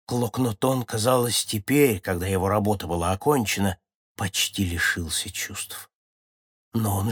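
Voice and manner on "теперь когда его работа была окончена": usual speaking voice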